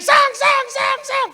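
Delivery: loud, very high repeated clang noises; crashing plates. Retold or imitated. Newlyweds' plate-throwing war, shouted crashing noises. Imitates plates flying in couple's fight.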